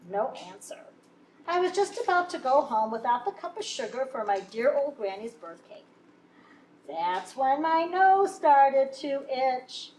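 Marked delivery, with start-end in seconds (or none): none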